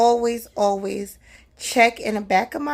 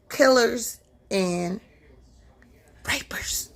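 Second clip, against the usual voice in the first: hushed unvoiced whisper; slower than usual. Real, not acted. Warning the ladies about killers, dropping to a whisper. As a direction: drop to a whisper on the word.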